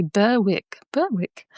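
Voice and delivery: posh "BUR-wick" with the W sounded; posh accent. Shown as a demonstration. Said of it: "say it in a posh way".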